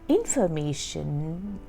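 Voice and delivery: ordinary delivery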